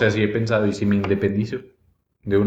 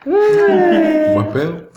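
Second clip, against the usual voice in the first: stretched high, sing-song buen pedo; mock drawn-out exclaim; 4 dB louder, much slower than usual. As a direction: draw it out slow and high. Drawn-out reply to workplace remark.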